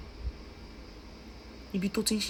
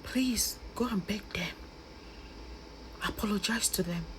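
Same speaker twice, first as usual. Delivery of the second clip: soft, subdued plea near tears; emotional pleading; 8 dB quieter than usual. Real, not acted. Begging Maureen's mother to apologise to them, emotional pleading. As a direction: plead quietly, holding back the tears. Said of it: "Sorry, I got really emotional".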